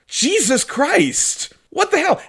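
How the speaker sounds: high, drawn-out disbelief; shocked outcry. Acted. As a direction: exclaim in appalled amazement. Acted shock at the game's ending.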